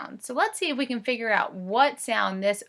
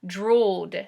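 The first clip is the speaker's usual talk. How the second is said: ordinary delivery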